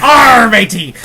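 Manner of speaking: loud hammed-up pirate growl. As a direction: bark it like a cartoon pirate. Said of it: "she doesn't talk like a pirate".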